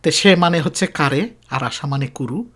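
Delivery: ordinary talk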